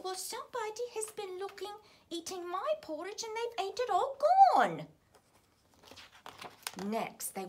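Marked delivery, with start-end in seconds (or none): 0.0-4.9 put-on Baby Bear voice. A narrator's reading.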